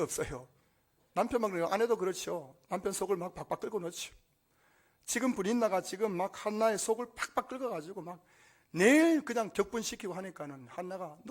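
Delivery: usual speaking voice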